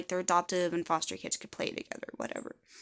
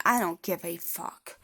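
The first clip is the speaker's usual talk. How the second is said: usual speaking voice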